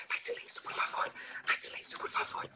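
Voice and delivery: whispered plea repeated over and over. Acted. Mother begging for silence in the night, repeated whisper. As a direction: murmur it like a prayer so nobody wakes.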